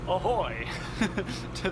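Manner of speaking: a sheepish laugh inside "Ahoy"; self-conscious giggly greeting; laughing through it. Shown as a demonstration. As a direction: say it as if too embarrassed not to laugh. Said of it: "it's hard to say".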